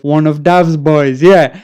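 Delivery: loud drawled street voice. Retold or imitated. Dealer greets them.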